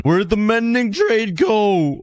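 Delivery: high, slow stretched whine; high drawn-out wail of loss; anguished whine. Real, not acted. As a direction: wail it, unable to believe it. Trade vanished after all that work.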